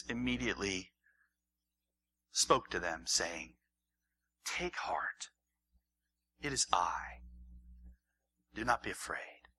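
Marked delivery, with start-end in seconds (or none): 4.4-9.5 whispered reassurance; soft whisper. Acted. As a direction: whisper it to calm frightened friends. Jesus voiced gently in story.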